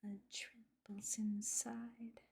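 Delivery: slow, breathy whisper of the lyrics. Acted. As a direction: whisper the song words slowly, almost unvoiced. Whispering [name] lyrics along.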